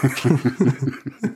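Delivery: chuckling at the answer; amused laugh. Real, not acted. Amused at promotion party answer. (amüsiertes Lachen)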